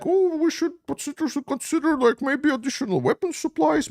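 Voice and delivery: high, prissy put-on voice of a hesitant official; mock the timid leaders in a high fake voice; mocking dithering politician. Mimicking hesitant European leaders on Ukraine aid, high mocking voice.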